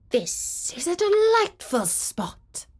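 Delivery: a blustery wind delighted; north wind. Acted. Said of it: "he said".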